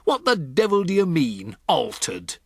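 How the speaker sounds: a pompous father demanding answers; angry demand. Acted. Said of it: "shouted Mister Jenkins".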